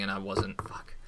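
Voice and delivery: guilty whisper. Real, not acted. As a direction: whisper it under your breath. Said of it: "whisper heard".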